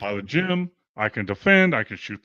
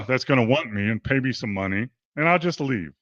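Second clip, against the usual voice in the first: ordinary delivery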